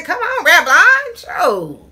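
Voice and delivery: usual speaking voice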